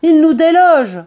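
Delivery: loud, high alarmed yell. Acted. Mr Fox warns family of the diggers, yelling. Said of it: "hurla-t-il".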